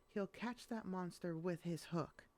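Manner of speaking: ordinary delivery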